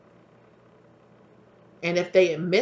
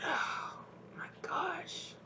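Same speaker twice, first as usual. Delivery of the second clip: whispered; 13 semitones higher, 13 dB quieter, much slower than usual. Real, not acted. Shocked aside to viewers.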